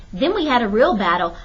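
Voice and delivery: ordinary delivery, nothing marked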